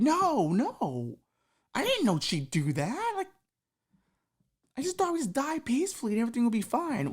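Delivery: high pleading voice; protest, embarrassed; guy explaining himself to Satan; whining guy. Acted.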